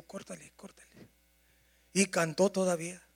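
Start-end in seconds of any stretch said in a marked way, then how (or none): 0.0-0.5 hushed cue. Retold or imitated. Pastor hushing singer from behind. 0.6-1.1 whispered cue. Retold or imitated. Pastor hushing singer from behind.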